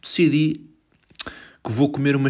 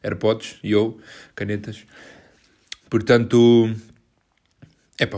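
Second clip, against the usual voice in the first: usual speaking voice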